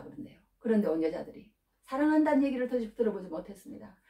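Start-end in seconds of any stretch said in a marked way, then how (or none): none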